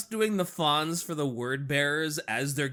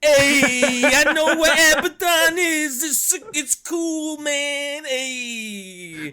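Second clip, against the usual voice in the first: much higher, loud, slow cool-guy Hey; Fonz impression; 10 semitones higher, 8 dB louder, much slower than usual. Co-host shows how the Fonz really sounds, loud and high. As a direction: do the Fonz, thumbs up, drawn out. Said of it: "I'm doing the thumb thing".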